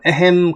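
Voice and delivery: drawn-out mock "ahem"; imitated throat-clearing. Old-time gentleman coughing to draw attention, imitated throat-clearing. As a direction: clear your throat slowly to get attention. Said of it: "기침만 하면 또 주목할 거".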